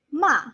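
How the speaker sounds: "ma" dropping sharply from high; fourth tone, falling. Shown as a demonstration. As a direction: send "ma" straight down. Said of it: "let's go down".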